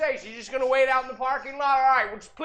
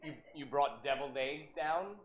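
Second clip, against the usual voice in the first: a hint of a patient old man; 9 semitones lower, 8 dB quieter than usual. Plays Arnold the plumber.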